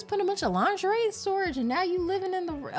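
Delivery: high, fast, disbelieving voice; incredulous high quote. Retold or imitated. Remembering reading the book, high and fast in disbelief. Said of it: "where I was like".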